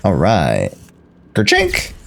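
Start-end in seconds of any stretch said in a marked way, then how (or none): 1.3-1.9 high mock camera click; camera noise. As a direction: play the shutter sound with your voice. Mimics camera sound for photos.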